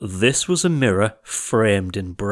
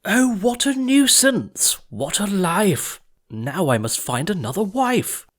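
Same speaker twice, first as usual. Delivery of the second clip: king crying out; 9 semitones higher than usual. A narrator's reading. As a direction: stretch it out in royal self-pity. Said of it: "up and cried".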